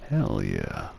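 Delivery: ordinary talk, nothing marked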